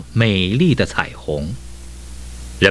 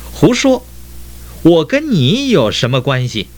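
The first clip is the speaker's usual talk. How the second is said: indignant, high-pitched dismissal; angry retort; 9 semitones higher than usual. A narrator's reading. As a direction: cut them off crossly, high voice. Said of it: "彩虹更生气了". (生气地高声顶撞)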